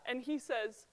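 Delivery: ordinary talk, nothing marked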